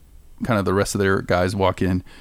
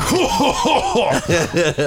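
mock villain laugh; 9 semitones higher, much slower than usual. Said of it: "And he's like".

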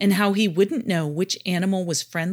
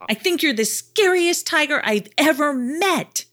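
high, loud, laughing excitement; 8 semitones higher, 4 dB louder than usual. A narrator's reading. Jardad admitting the tiger scared him, laughing. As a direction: burst out giddily, voice jumping high. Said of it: "Jardad started laughing too".

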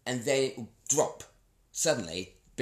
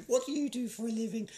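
high, grand mother's question; posh queenly mother; 13 semitones higher than usual. Retold or imitated. Imitating his regal mother quizzing him, high and grand. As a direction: ask it like a stately matriarch. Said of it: "my mother is the queen".

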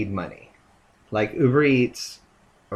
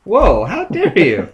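mock offended; 6 semitones higher, 7 dB louder than usual. Teasing reply to "What hair?.

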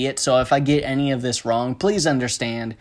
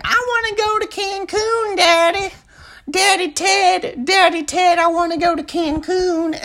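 very high-pitched mock child pleading; exaggerated little-kid whine, way up high; whiny kid; 3 dB louder than usual. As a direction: squeal it like a kid begging daddy. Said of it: "like, kid saying, like".

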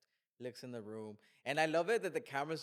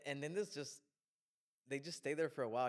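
ordinary talk, nothing marked

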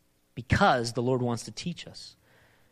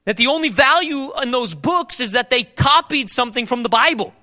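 loud, high, urgent preacher's call; forceful preaching; 9 semitones higher, 8 dB louder than usual. Acted. Preacher pressing the congregation on seeking wisdom, forceful. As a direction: drive the question home from the pulpit.

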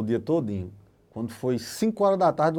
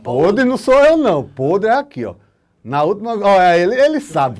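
shouting it off himself, much louder and higher; loud protest; 5 semitones higher, 9 dB louder than usual. Real, not acted. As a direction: push the blame away, laughing outrage. Acusado pelos amigos, protesta. (protesto alto, jogando a culpa no amigo)

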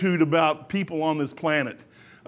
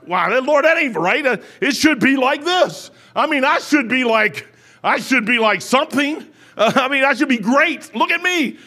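loud, higher-pitched protest to God; worked-up, self-important grumbling; indignant complaint; 4 semitones higher, 4 dB louder than usual. Acted. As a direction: complain emphatically, punching each phrase.

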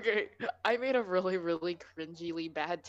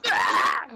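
short loud fake yell; mock scream; 9 semitones lower, 11 dB louder than usual. Podcaster cries out in a badly faked yell. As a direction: let out a hammed-up cry. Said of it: "My acting is so bad".

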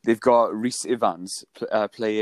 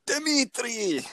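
put-on Reznov Russian accent; 12 semitones higher, 4 dB quieter than usual. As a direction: shout the name in a hammy Russian accent. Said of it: "That's all I can do".

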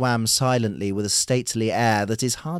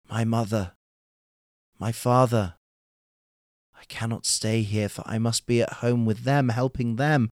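heavy-hearted. A narrator's reading. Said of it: "with a heavy heart".

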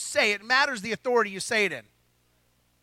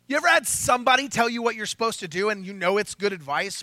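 usual speaking voice

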